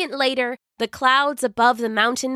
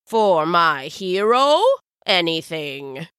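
put-on fond old wizard; 3 semitones lower, slower than usual. Wizard fondly agrees.

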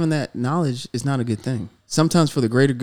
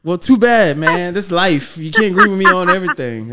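loud, higher-pitched brush-off; defiant retort; 4 semitones higher, 6 dB louder than usual. Real, not acted. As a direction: push back hard at the critic. Retorts to a disagreeing listener.

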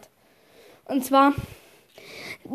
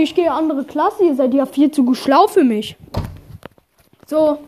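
ordinary talk

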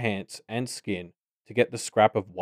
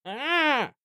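very high, sustained shriek; pained shriek. A narrator's reading. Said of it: "Bumcake shrieked".

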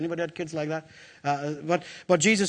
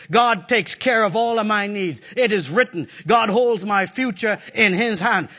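ordinary talk

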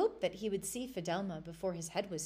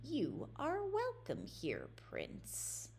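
witch voice; 6 semitones higher, 5 dB quieter, much slower than usual. Acted. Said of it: "and she said".

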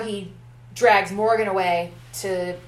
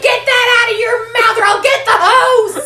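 much higher, louder mom yell; scolding mom; 13 semitones higher, 10 dB louder than usual. Retold or imitated. Mother yelling at her child to stop talking back, high mock holler. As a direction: threaten the kid with the hose.

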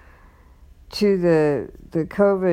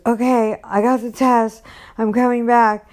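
higher-pitched, eager report back; mildly excited, imitating his phone update; excited retelling; 7 semitones higher, 4 dB louder than usual.

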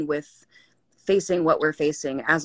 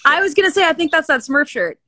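pleasantly surprised; 11 semitones higher, 7 dB louder than usual. Real, not acted. Recognizing the Smurf shirt.

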